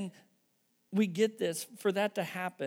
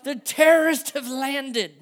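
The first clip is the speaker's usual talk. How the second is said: high, loud mock panic; panicked posts; 8 semitones higher, 13 dB louder, slower than usual. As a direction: ham up the frightened Facebook crowd. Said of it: "the people went crazy on the".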